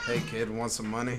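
low, soft creepy offer; creepy Epstein voice. Pretend. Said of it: "my Epstein impression".

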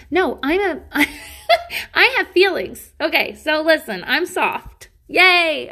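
high, loud, laughing protest; giggly self-affirmation. Pretend. Host correcting her own self-deprecation, high giggly cheering.

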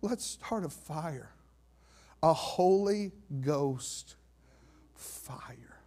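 drawn-out words fading to a whisper; slow and weighty, dropping to breath; slow, hushed emphasis. Real, not acted. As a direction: stretch each word, then whisper the last one. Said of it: "when you say it like that".

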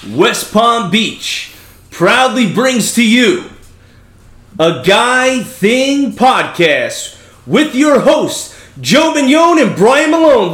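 booming MC announcer voice; ringmaster. Acted. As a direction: hype the crowd like a circus ringmaster. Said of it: "I'll throw the old M C voice".

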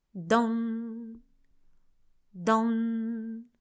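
don don lenti e sostenuti, come un pendolo; rintocchi d'orologio. A narrator's reading. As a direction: imita i rintocchi della mezzanotte. Orologio che batte mezzanotte. (rintocchi del pendolo a mezzanotte, lenti e tenuti)

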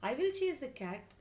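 high, loud bold declaration; brave. A narrator's reading. Mother promises to chase out the mouse, brave. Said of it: "said mother bravely".

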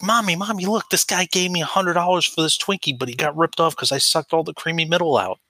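put-on high excited child voice; excited kid. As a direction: play a thrilled little kid. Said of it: "he's like".

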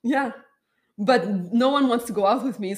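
normal talking voice

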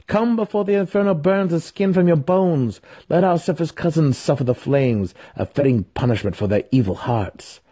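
urgent cleric. A narrator's reading. Cleric urging Driz to leave the burning light. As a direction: hurry him out of the fire.

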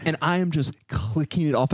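normal talking voice

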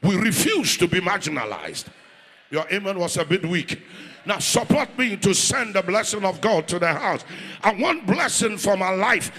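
loud rousing sermon call; preaching at full force. Acted. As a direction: fire up the crowd, loud. Rallying the congregation to bless.

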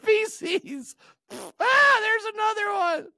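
high squeaky frantic cries; cute bear screaming for help. Pretend. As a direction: shriek like a trapped little toy. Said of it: "Just a little cute bear".

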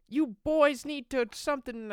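high-pitched mock yell, no real volume; yelling woman. Woman in a trailer scolds the men, mock yell. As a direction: imitate a woman scolding the boys. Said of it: "some woman that yells".

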